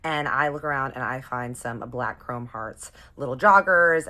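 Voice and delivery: ordinary talk, nothing marked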